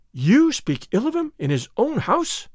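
high, slow indignant question; affronted lady, pitched high. A narrator's reading. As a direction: stretch the words in rising outrage. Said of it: "her eyes flashing".

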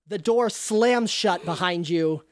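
ordinary delivery

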